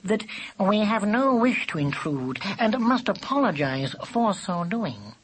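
smooth, high courtly apology; silky. A narrator's reading. Cat apologising to a badger for intruding, silky. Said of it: "he said silkily".

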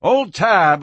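ordinary delivery, nothing marked

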